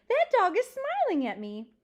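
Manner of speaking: high, loud, swooping exclamation; delighted amazement. Acted. Old librarian noticing the dog smile at her, delightedly amazed. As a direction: marvel aloud with big pitch swings.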